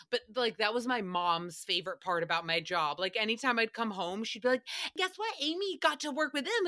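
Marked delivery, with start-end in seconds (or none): 5.0-6.7 higher-pitched gushing mom impression; excited mom. Mom bragging about her daughter's celebrity job, excited and high. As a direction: imitate mom proudly announcing big news.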